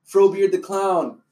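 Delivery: slow, loud circus billing; bill it like a ringmaster; mock introduction of a clown act; showman announcement.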